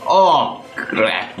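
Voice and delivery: ordinary delivery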